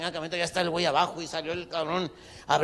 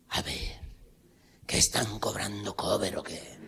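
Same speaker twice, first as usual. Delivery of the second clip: whispered aside; 6 semitones lower, 4 dB quieter than usual. Acted. Compadre asks about cover charge.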